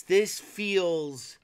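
ordinary talk

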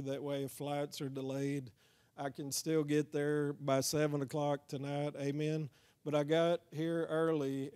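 usual speaking voice

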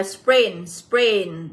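"sprain" pronounced as an English word, said twice; model pronunciation. Shown as a demonstration. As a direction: say "sprain" as a reading model, then repeat it. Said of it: "các em sẽ được đọc là". (phát âm mẫu từ "sprain")

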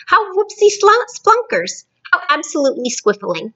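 high-pitched gleeful exclaiming; overjoyed, pitch leaping up; beaming delight. A narrator's reading. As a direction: burst out in giddy delight. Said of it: "still beaming".

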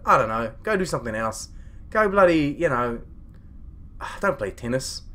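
ordinary talk, nothing marked

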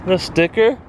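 usual speaking voice

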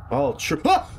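sudden high yelp of fright; startled yelp. Real, not acted. Player jumps at something, startled high yelp. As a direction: jump and yelp mid-word.

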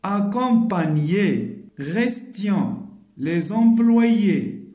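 slow, loud, each word said alone; slow clear word list; word reading drill. Shown as a demonstration. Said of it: "Je lis bien les mots".

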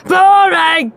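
loud, high, rude heckle; shouting. A narrator's reading. Henry heckling Claire's pi recital, shouting. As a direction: yell it out like a bored boy. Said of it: "shouted Horrid Henry".

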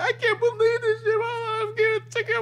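very high-pitched whimpering wail; mock crying. Driver with a tissue lamenting her ticket, mock high crying. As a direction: bawl it in a squealed fake cry. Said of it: "And she's crying".

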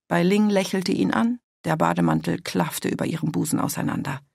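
ordinary delivery, nothing marked

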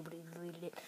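soft unvoiced whisper. Real, not acted. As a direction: whisper the aside. Whispered aside.

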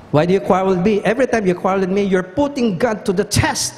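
a hint of fed-up Moses; exasperated Moses.

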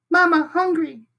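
put-on very high, tiny baby-bird voice; peeping gosling. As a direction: peep it like a hungry little gosling. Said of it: "Brightbill started peeping".

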